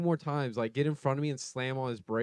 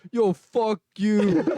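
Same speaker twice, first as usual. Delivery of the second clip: loud, slow, higher yo fuck you; retold yell; 6 semitones higher, 6 dB louder, much slower than usual. Little brother yelling at a road rager, slow and loud. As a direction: spit it out at the other driver. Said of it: "and he goes".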